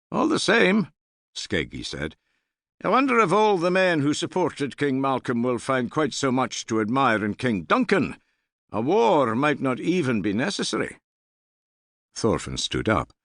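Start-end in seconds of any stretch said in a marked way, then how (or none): 0.1-0.9 high, doubtful voice of a warrior; a touch of dry scepticism; Skeggi's voice. A narrator's reading. Skeggi doubting King Duncan before the men, high and wry. 2.8-11.0 high, doubtful voice of a warrior; a touch of dry scepticism; Skeggi's voice. A narrator's reading. Skeggi doubting King Duncan before the men, high and wry.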